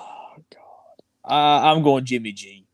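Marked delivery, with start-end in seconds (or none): 0.0-1.3 soft, voiceless "oh God"; exasperated under-the-breath whisper; whispered dismay. Real, not acted. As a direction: whisper it in dread of the choice. Dreading the awful quarterback choice.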